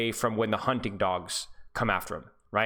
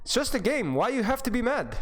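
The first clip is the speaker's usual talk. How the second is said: higher, mock Russian-accented game voice line; Zarya voice line; 8 semitones higher than usual. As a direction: quote Zarya playfully in her accent. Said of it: "remember the Zarya voice line?".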